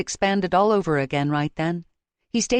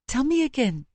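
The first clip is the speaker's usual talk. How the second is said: teary request; 7 semitones higher than usual. A narrator's reading. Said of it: "A teary but firm request".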